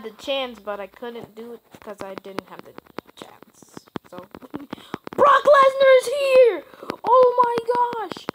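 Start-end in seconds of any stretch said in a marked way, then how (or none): 5.2-8.3 high, loud announcer-style shout of a surprise entrance; excited shout. Acted. Gamer shouting at a surprise entrant in the match, in a high, loud, excited yell. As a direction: yell it like the crowd just erupted.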